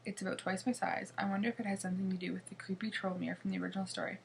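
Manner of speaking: ordinary delivery, nothing marked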